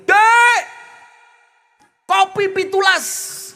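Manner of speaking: teriakan keras suami ke dapur; yelling across the house. Retold or imitated. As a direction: panggil istri dari depan, keras dan panjang. Husband yelling to kitchen. (suami berteriak menyuruh istri membuat kopi)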